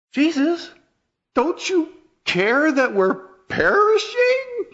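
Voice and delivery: very high, loud, drawn-out cry of panic; terrified plea. Acted. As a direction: beg him like you're drowning. Disciples cry out in storm.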